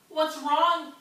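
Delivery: lightly voiced friendly frog asking. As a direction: ask gently as a friendly frog. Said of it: "asked the friendly frog".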